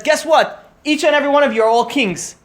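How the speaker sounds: high, loud joyful announcement; excited proclamation. Acted. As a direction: proclaim it like wonderful news.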